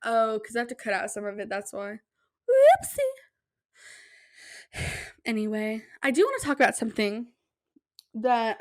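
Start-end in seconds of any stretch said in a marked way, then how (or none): 2.5-3.0 much higher, louder cutesy oops; playful whoopsie. Real, not acted. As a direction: squeak it out playfully. Realizing she must cut footage.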